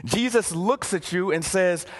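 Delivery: usual speaking voice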